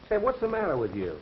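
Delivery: annoyed challenge. Acted. Reporter challenging the man who questions his visit, annoyed.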